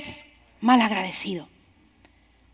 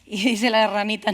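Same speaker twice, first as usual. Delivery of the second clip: usual speaking voice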